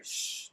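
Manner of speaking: hissed "shh" like spray; spraying water sound. Shown as a demonstration. Imita o som da água borrifada. (som de água borrifando, "shh")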